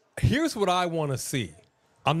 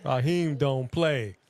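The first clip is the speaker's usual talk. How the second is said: ordinary talk, nothing marked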